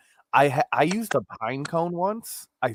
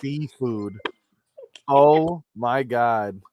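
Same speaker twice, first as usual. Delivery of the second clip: ordinary delivery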